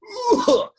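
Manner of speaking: light rattly smoker's cough; smoker's rasp. Shown as a demonstration. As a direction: cough like a happy old smoker. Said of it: "So this is the smoker's cough".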